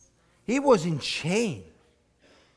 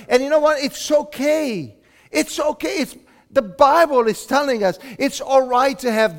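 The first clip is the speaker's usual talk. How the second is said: high, loud, fervent preaching; preaching at full force; 6 semitones higher, 7 dB louder than usual. Acted. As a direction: lift the room with it.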